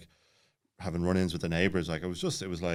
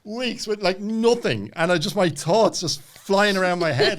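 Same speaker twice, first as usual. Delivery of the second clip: animated; 13 semitones higher, 8 dB louder, slower than usual. Real, not acted. Retelling silent retreat torment.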